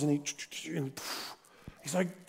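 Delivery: ordinary delivery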